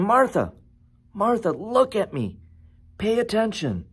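high, falling insistent appeal; getting her attention. Acted. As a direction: coax a distracted listener back to focus. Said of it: "in order to get her attention".

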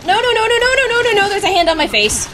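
rapid high panicked no's; scared screaming. Real, not acted. Hand on her face in game.

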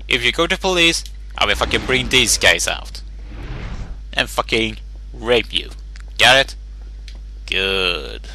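ordinary talk, nothing marked

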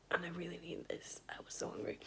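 soft unvoiced whisper. Real, not acted. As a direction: whisper it so nobody wakes. Whispering late at night, hungry.

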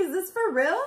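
high, loud incredulous question, retold; confused disbelief. Woman retelling her doubt at the proposal, confused disbelief. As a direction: replay overwhelmed disbelief. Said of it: "Like, so confused".

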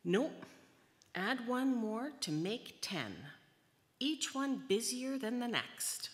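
high, loud, emphatic storytelling; animated storybook reading. A narrator's reading. Reader telling children of a tenth busy Leo, animated and loud. Reads picture book to children.

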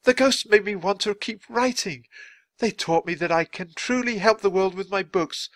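high-pitched, eager resolve; inspired writer. A narrator's reading. Writer telling children he must work at once, elated. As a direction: tumble onward with new purpose.

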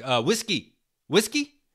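lightly imitated Russian accent, rising on the second "whiskey"; a hint of a Russian guy offering "whiskey?" with broken English. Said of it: "He's like, he's like".

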